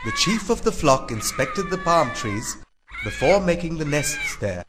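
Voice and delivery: ordinary delivery, nothing marked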